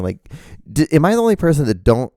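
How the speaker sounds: ordinary talk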